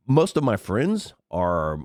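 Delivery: usual speaking voice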